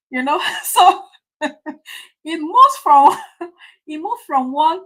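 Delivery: slow, laughing words, voice breaking into chuckles; laughing through words. Real, not acted. Preacher to congregation, laughing at the memory. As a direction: laugh through it as you talk.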